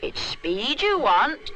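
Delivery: put-on slow, knowing old woman; a hint of a mysterious granny; wise old granny. A narrator's reading.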